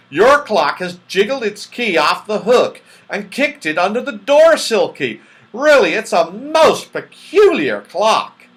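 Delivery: loud, rising gasp of wonder; astonished. A narrator's reading. Moonface sees the key slide under the door, loud and amazed. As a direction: point out the surprise. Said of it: "said Moonface in astonishment".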